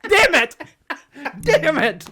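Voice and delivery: loud, high comic frustration; exasperated. Real, not acted. Storyteller who told the real story by mistake, loudly exasperated. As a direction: kick yourself out loud over the slip. Kicking himself for spoiling the story.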